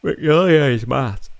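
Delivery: a hint of a put-on accent; character voice. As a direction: snap the line in a character's voice.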